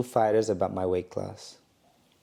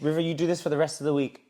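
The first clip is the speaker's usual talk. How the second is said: quick, higher put-on coach voice; mock trainer; 5 semitones higher, faster than usual. Trainer gives River orders in a light mock voice.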